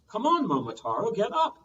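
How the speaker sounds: put-on old man urging Momotaro up, loud; urgent call. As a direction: rouse the sleeper. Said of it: "The old man said".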